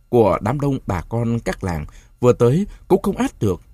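ordinary delivery

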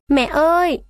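high anguished cry; boy suddenly crying out for his mother, high. Acted. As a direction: call out to mother, breaking down. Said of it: "bật lên tiếng kêu và khóc nức nở".

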